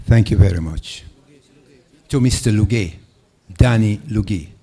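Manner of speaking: loud, projected voice; speaking up. Real, not acted. Questioner thanking the panel and naming the candidate, loudly projected. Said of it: "speak up".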